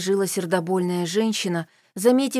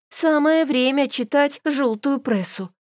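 grumbling; 6 semitones higher, slower than usual. A narrator's reading. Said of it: "проворчала я".

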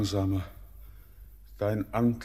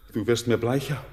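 usual speaking voice

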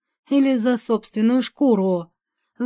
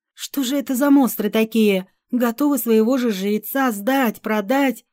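plain reading voice

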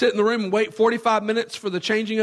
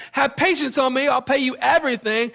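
higher-pitched mock plea; acted pleading; 7 semitones higher, 3 dB louder than usual. Debtor begging fellow servant for patience, acted pleading. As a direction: plead like a debtor asking for time.

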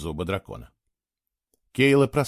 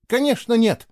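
higher, louder indignant outburst; exploding; 11 semitones higher, 4 dB louder than usual. A narrator's reading. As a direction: burst out in indignation. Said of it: "взорвалась Кейла".

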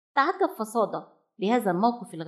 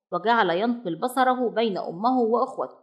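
usual speaking voice

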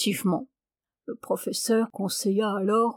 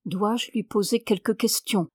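ordinary delivery, nothing marked

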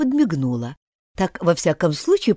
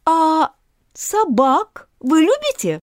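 put-on a bit higher, pleading young girl; gently coax, a touch girlish; a hint of a polite little girl pleading; lightly voiced girl Alice; 9 semitones higher, slower than usual.

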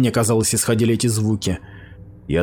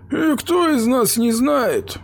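sighing; slower than usual. Acted. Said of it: "вздохнул Якобсон".

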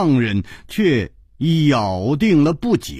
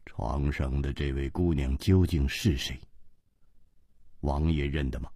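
low, soft and grave; 12 semitones lower, 10 dB quieter than usual. Acted. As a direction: ask in a hushed low voice. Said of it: "沉声说". (低沉而克制地问)

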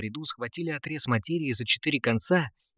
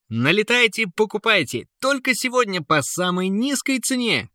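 market hawker call; 10 semitones higher than usual. A narrator's reading. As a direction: shout out the bargain. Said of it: "громко зазывал он прохожих".

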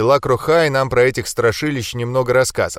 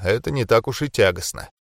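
ordinary delivery

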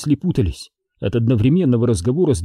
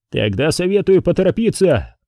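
raised, mildly shouted advice; shouted warning; 6 semitones higher than usual. A narrator's reading. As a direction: call a warning over to the enemy. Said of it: "вновь крикнул Доронин". (предупреждающий окрик)

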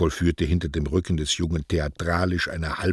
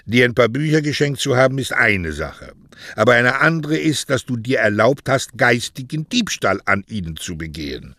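stern reproach; 6 semitones higher, 5 dB louder than usual. A narrator's reading. Poet reproaches Mario.

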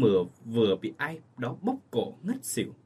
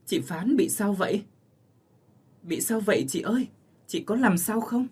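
high, hurried and worried; anxious call; 8 semitones higher than usual. Acted. Bà Cai Tổng calling to the choking mother, high alarm. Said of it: "vội lên tiếng".

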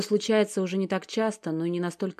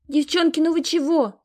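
much higher, whiny and pleading; whimpering plea; 11 semitones higher, 6 dB louder than usual. Acted. Stezhka begs her fighting sisters to stop, whiny and high. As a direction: whine at the quarrelling sisters to stop. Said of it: "захныкала Стежка".

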